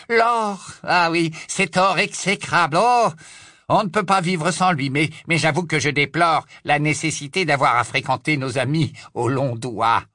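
lightly voiced suave Death Eater; a hint of a smug wizard; Travers voice.

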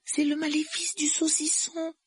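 put-on very high, regretful girl's voice; small apologetic voice; sorrowful. A narrator's reading. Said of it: "d'une voix navrée".

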